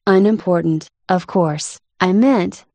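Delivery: plain reading voice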